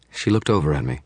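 plain reading voice

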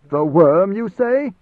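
loud, provocative echo rising high at the end; unnecessarily loud. A narrator's reading. Commander repeating the messenger's slip for eavesdroppers, deliberately loud. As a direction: boom the first words, then lift into a question. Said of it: "voice was unnecessarily loud".

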